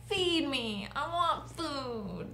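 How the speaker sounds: put-on high, slow, whining kid voice; whiny brat kid. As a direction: whine it like a spoiled child. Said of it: "but a whiny brat kid".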